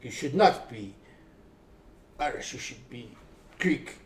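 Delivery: lightly imitated Greek boss; Greek accent. Restaurant boss tells young worker he should be Greek, mild Greek accent. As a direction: put on a rough, unsure Greek accent. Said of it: "no idea if that's a Greek accent".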